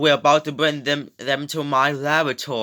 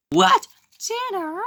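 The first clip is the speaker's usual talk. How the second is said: much higher, shocked squeak. Pretend. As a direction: squeak in disbelief. Kids shocked at being dinner.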